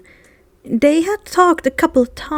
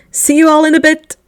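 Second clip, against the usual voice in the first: calling out; 3 semitones higher, 6 dB louder, faster than usual. A narrator's reading. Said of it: "Harry called over his shoulder".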